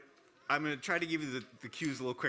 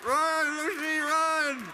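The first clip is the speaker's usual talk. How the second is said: shouting; 8 dB louder, much slower than usual. Real, not acted. Urging assistant to flee.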